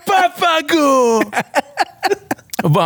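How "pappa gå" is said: high mock tantrum cry; put-on child's high-pitched yell; screaming child. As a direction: imitate a kid shrieking at a parent. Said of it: "och bara skriker".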